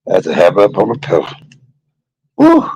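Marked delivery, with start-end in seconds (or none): 2.4-2.7 high pained woo. Real, not acted. Exclaiming in pain.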